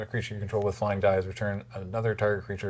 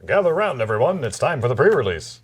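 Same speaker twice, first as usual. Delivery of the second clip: announcer voice; 2 semitones higher, 7 dB louder than usual. Pretend. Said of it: "sing it in, like, an announcer type voice".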